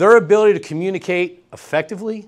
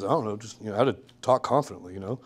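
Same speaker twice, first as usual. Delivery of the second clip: imitating unengaged intern; 6 semitones lower, 8 dB quieter, faster than usual. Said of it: "he's literally looking at me".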